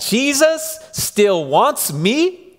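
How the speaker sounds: overwhelmed, can't believe it; amazed disbelief. Acted. Peter realizes Jesus still wants him, amazed. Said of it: "Peter realizes".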